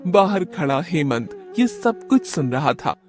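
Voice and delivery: usual speaking voice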